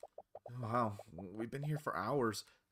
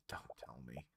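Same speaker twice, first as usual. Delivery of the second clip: hushed, dreading whisper, much softer; 11 semitones lower, 17 dB quieter than usual. Acted. Seeing the Inquisition arrive, a dreading whisper. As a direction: breathe it under your breath.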